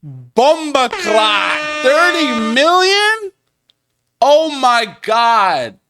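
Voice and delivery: drawn-out, high, loud astonishment; shocked disbelief. Real, not acted. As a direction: stretch each word out in amazement. Reacting to thirty million dollar deal.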